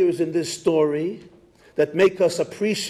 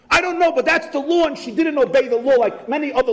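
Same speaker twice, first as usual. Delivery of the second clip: higher, fast indignant retort; indignant husband; 8 semitones higher, faster than usual. Retold or imitated. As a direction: snap back defensively. Angry husband retorts at rabbi.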